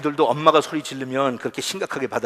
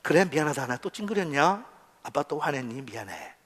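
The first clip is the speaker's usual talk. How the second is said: gentle apology; 5 dB quieter than usual. Acted. Father apologising to children for his temper, gentle. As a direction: back down tenderly and own up.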